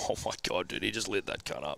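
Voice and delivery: murmuring so the angry dad won't hear; awed hush. Retold or imitated. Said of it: "walking behind Dad being like".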